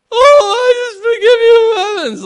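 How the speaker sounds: very high, loud, hammed-up falsetto wife; bad wife impression. Husband mimics his wife forgiving him, bad high-pitched impression. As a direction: overdo a gushing, shrieky wife forgiving. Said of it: "I'm not good at impressions".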